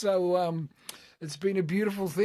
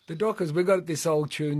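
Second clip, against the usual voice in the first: ordinary delivery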